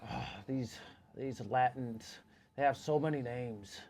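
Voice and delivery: put-on quiet, drawn-out grumble of a puzzled old bigot.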